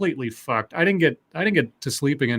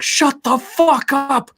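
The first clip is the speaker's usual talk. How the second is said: high, drawn-out exasperated shout; neighbor's angry yell; 10 semitones higher, 3 dB louder, much slower than usual. Retold or imitated. Imitating a neighbor yelling at the screamer, exasperated shout. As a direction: stretch every word in an imitated yell. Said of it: "neighbors was running out there going like".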